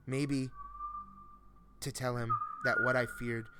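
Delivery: usual speaking voice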